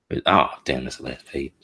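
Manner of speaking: ordinary delivery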